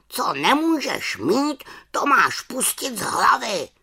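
high raven voice giving advice; raven croak. A narrator's reading. Raven telling the witch to let it go, high croak. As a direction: croak firmly as a wise bird. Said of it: "krákal havran".

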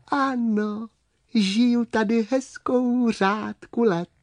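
small high-pitched creature; high little storybook voice; little worm voice. Acted. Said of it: "ozval se tichý hlásek".

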